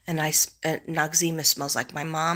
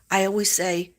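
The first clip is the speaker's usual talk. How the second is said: ordinary delivery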